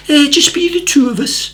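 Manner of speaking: high coy Scottish voice; Scottish accent. Retold or imitated. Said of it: "deadpan, beautiful Scottish accent".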